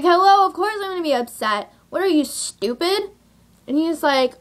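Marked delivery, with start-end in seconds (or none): 0.0-3.1 high-pitched, incredulous snapback; exasperated retort. Retold or imitated. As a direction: throw it back, high and exasperated. Acted angry retort to date.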